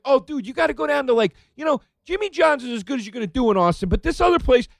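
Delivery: fast, high, eager cabbie; excited. Retold or imitated. Cab driver eagerly recommends a sandwich place, fast and high. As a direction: rattle it off high and quick like a keen local. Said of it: "the guy's like".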